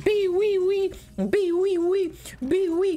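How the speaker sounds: very high squeaky laser noise; laser tag beeps. Retold or imitated. Imitates the laser tag guns with high beeping noises. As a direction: mimic toy laser blasters.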